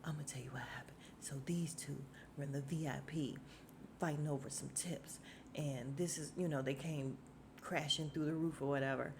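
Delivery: low, hushed imitation of Tommy; mock murmured, near-whispered retelling; odd hushed voice. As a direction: imitate his strange quiet way of talking. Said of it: "Why does he talk like that?".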